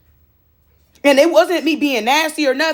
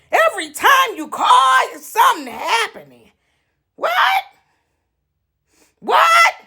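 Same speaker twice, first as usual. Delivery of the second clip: shrieking exasperation; 8 semitones higher, slower than usual. Acted. Exasperated yelling at caller.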